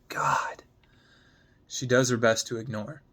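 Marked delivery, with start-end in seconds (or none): 0.1-0.6 unvoiced exasperated breath of a word; whispered under his breath in frustration. Sam whispering in frustration as Gemma walks away, breathy exasperated whisper.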